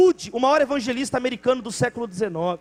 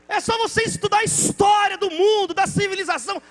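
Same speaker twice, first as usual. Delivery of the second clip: loud, high preaching at full force; 6 semitones higher, 7 dB louder than usual. Acted. Preacher exhorting the church, loud preaching. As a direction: proclaim it to the congregation.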